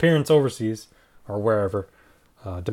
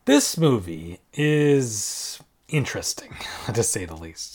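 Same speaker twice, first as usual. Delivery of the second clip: whispered aside; 4 semitones lower, slower than usual. Real, not acted. Slow whispered aside on film.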